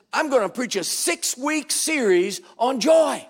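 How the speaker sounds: loud, slow, angry shout; angry announcement. Retold or imitated. Angry pastor announcing a joy series, slow furious shout. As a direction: announce it through clenched fury. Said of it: "Made me so angry".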